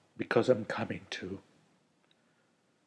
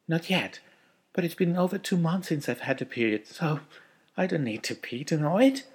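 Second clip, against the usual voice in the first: a hint of a higher wife voice, happy; lightly voiced wife; 6 semitones higher, 6 dB louder than usual. A narrator's reading. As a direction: lift the voice a little for the happy wife. Wife voiced by male narrator.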